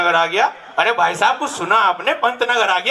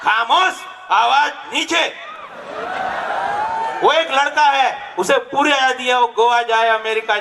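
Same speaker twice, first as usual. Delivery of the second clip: angry shout; 5 semitones higher than usual. Acted. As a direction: shout her down like a strict father. Father shouts at daughter. (गुस्से में चिल्लाना)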